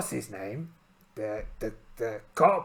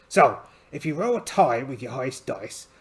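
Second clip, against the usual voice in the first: ordinary talk, nothing marked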